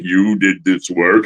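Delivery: low, slow, weighty question; imitated deep, deliberate actor voice; Cary Tagawa impression. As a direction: ask it slowly like a stern villain. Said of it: "he's got that voice".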